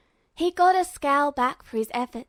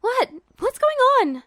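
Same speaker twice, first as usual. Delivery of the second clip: high, loud panicked yell; frightened yell; 12 semitones higher, 6 dB louder than usual. A narrator's reading. Trapped girl yelling for answers, high and panicked. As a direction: cry out in alarm, much higher. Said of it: "Hild yelled".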